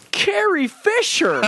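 high, slow delighted exclaim; dad blurting out. Retold or imitated. Dad spotting Princess Leia in the theater, slow high blurt. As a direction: drag it out like a thrilled dad. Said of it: "my dad turns to me in full volume, he goes".

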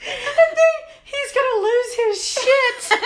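high, loud wail, drawn out and half laughing; laughing dread. Retold or imitated. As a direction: wail it slowly through laughter. Retold dread of husband's reaction.

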